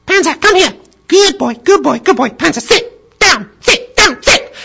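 very high, loud squeaks; squeaky little girl. Retold or imitated. Little girl commanding her big puppy, squeaky. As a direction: squeak out commands like a six-year-old. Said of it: "in a squeaky voice".

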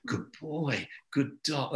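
soft praise. Shown as a demonstration. Quiet praise to dog.

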